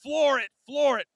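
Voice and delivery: high, urgent "floor it"; mock-frantic plea to the cabbie; urging the cab driver. Urging cab driver, rushing back.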